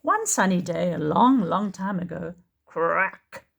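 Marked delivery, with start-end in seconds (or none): none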